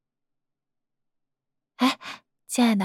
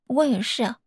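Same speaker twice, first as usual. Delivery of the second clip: ordinary delivery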